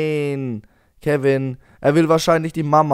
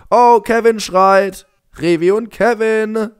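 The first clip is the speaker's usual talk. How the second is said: raised, high-pitched alert; alarmed calling out; 7 semitones higher, 5 dB louder than usual. Pretend. Player alerts game father to crying baby, alarmed.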